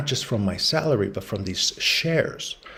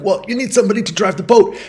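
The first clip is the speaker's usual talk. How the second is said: much higher, louder, fast eager pitch to join; desperate husband; 10 semitones higher, 5 dB louder, faster than usual. Retold or imitated. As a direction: blurt it out, scrambling for a reason to come. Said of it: "And the husband's like".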